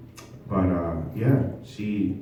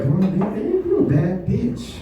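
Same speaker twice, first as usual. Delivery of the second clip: high, slow, gushing hype; hyping her up; 9 semitones higher, slower than usual. Retold or imitated. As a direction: cheer her on, dragging it out. Hyping girlfriend to hurry.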